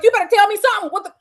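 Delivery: high, loud, rapid demand; reenacted urgent demand. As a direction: fire it out like an alarmed parent. Alarmed parent demanding answers, reenacted.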